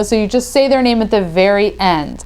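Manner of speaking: normal talking voice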